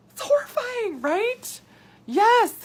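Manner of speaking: high, bright squeal of disbelief; excited outburst. Real, not acted. Host reacting to viewers' diet stories, excited high squeal. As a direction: squeal it out, shocked and thrilled.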